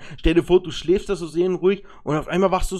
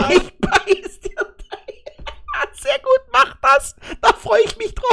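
silly high voice. Acted. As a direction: squeak it back like a cartoon. Mocks the threat in a silly voice.

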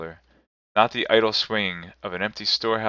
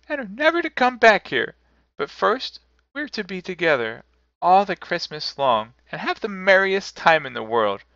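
put-on high, excited child's voice; gleeful little girl; 11 semitones higher, 3 dB louder than usual. A narrator's reading. Little sister promising Christmas together, high and joyful. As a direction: promise it all in a small girl's pitch. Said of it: "said the child, opening her eyes".

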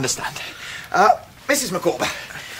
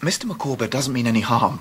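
ordinary delivery

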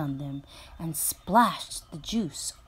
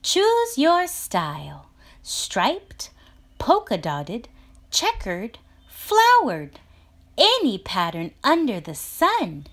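higher, bright sales pitch; eager salesman; 6 semitones higher, 5 dB louder than usual. Acted. Chameleon offering patterns to the animals, eager pitch. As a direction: tempt them with every pattern.

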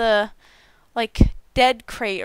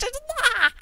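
excited squeal; 13 semitones higher, 4 dB quieter than usual. Real, not acted.

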